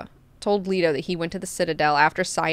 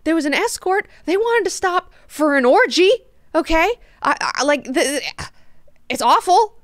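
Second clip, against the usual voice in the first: much higher, put-upon whine; whiny complaining voice; 9 semitones higher than usual. Retold or imitated. Duncan whining to Leto about the escort, much higher. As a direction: complain like it's unbearable. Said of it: "Leto's like".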